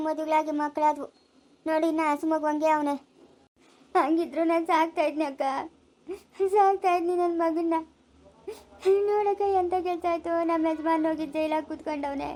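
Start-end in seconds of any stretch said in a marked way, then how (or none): 3.9-12.3 tearful lament, words drawn into a wail; bereaved mother's helpless weeping; crying. Acted. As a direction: pour out your grief through tears. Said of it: "ಅಳ್ಬೇಡ ಸುಜಾತ".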